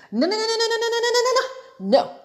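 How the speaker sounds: very high rapid-fire string of noes; emphatic refusal. Real, not acted. Storyteller objecting to the guy cutting in, emphatic refusal. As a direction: rattle it off way up high. Rejecting what the man did.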